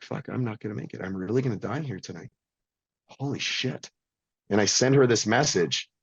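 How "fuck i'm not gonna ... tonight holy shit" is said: quiet despair. Retold or imitated. Expecting to die in ICU.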